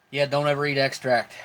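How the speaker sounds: ordinary talk, nothing marked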